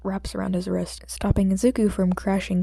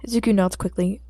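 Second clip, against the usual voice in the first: ordinary delivery, nothing marked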